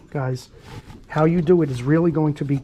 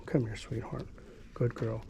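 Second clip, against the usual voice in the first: soft coaxing; 4 semitones lower, 14 dB quieter than usual. Real, not acted. Coaxing the dog close.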